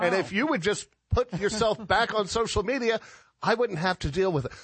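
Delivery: high-pitched comic pleading complaint; mock exasperation. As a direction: gripe at your colleague, playfully fed up. Acted complaint to absent colleague.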